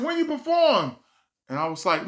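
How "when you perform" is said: high loud mock mom. Mom asking son when he performs, high mock voice. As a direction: play mom high and pushy.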